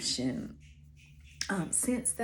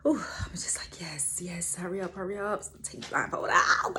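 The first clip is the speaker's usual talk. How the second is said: whispering. Retold or imitated. Swooning, eager inner voice.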